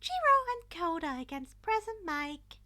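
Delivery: high, loud chirpy announcing voice; cheerful principal. A narrator's reading. Principal announcing the teams to the class, high cheerful call. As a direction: announce it like a bubbly host.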